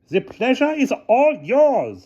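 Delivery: very high, drawn-out rude retort; shrill, slow and insolent guard. A narrator's reading. Guard answers Hannah Frankenstein, rudely in a high voice. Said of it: "said the guard rudely".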